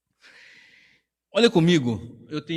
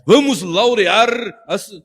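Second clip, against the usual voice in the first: slow, louder hammed-up sermon call; mock pompous preacher; 4 semitones higher, 5 dB louder, slower than usual. Pastor ridiculing showy preachers' vocabulary, slow hammed-up call. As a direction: drag out the fancy word, mocking. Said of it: "eu nem sei o que que é laurear".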